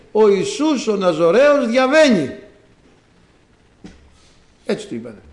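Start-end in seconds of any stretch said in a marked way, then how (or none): 0.1-2.3 loud, slow, high announcement; proclaiming. Acted. Crowd telling the blind man who passes, loud proclaiming. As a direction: call it out grandly over a crowd. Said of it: "Και του λένε".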